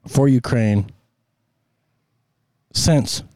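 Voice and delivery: usual speaking voice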